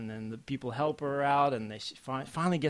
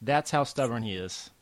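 normal talking voice

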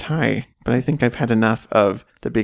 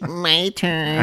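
imitating a funny voice; 6 semitones higher than usual. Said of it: "What was that voice?".

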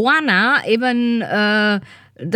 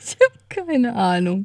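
laughing. Real, not acted. Host laughs at her co-host's pun, then shrugs it off. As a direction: burst of high laughter, then a slow giggly line.

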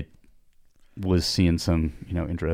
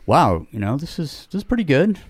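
higher, lightly voiced Italian doctor; impressed coach voice, pitched up; quoted doctor; 8 semitones higher than usual. Retold doctor praising test results.

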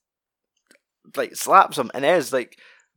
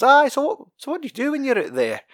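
imitating a nosy questioner, much higher and fast; mock George snooping; 13 semitones higher than usual. George fishing Simone for information, mock high voice. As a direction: pitch it way up, prying. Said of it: "he's, like, asking us".

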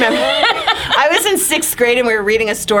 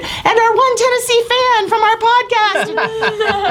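high, slow mock-announcer caption; laughing mock caption for the one fan; mock grand caption; 13 semitones higher, slower than usual. Reading the photo caption mockingly.

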